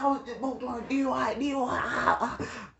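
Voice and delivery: hammed-up cousin bragging he was right; annoying cousin. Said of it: "he going crazy".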